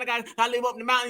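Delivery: fast, hurried mock of her excuse; rushed mock quote. Host mocking the woman's excuse, fast and hurried. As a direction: rattle it off fast like she's in a hurry. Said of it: "Now it's like".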